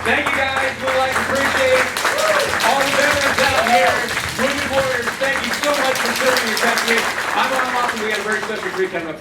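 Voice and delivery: stage announcer thanking the veterans, loud. Acted. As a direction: boom it out to the whole hall. Emcee thanks the crowd after the act.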